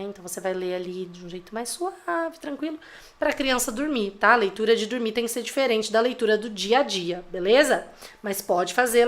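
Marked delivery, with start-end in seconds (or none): 0.0-4.1 soft, gentle bedtime reading voice; calm bedtime voice. Shown as a demonstration. Mother showing parents the soft bedtime story voice. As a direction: lull a child to sleep, softly. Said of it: "naquela voz mais calma".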